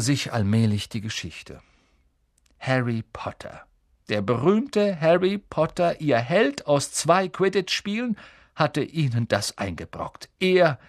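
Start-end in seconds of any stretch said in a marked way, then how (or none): 2.6-3.6 whispered name; hushed gossip. A narrator's reading. 4.1-8.2 raised pitch, mock-grand build-up; high, emphatic mock awe; mock admiration. A narrator's reading. Narrator mocks the fallen hero's fame.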